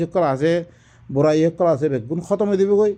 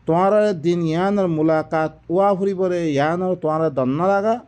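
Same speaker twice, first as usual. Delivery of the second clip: ordinary delivery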